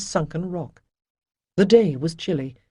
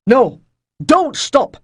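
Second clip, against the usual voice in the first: louder, higher sharp order; whip-crack command; 8 semitones higher, 10 dB louder, slower than usual. Acted. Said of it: "Rydell's voice cracked like a whip".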